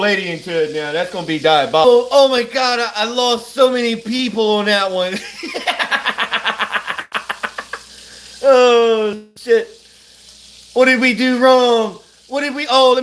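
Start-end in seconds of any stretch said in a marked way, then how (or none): 5.2-7.8 airy high laugh; wheezing laugh. Real, not acted. Laughing at losing viewers, airy and high. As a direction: laugh breathlessly.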